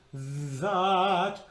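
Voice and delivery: buzzed TH in "That"; tongue out, voice the TH; voiced TH, tongue between teeth; voiced TH demo. Shown as a demonstration. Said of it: "That is a voiced T H".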